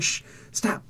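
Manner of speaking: hushed, whispered shush; soft whispered telling-off; mock shushing. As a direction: imitate someone silencing a friend under the breath. Voicing Nick Fury shushing someone.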